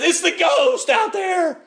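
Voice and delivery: high, long-drawn holler; hammed-up alarm, stretching the words; mock crying out. Disciple crying out at the figure on the sea, mock alarmed holler. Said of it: "One of the disciples cries out".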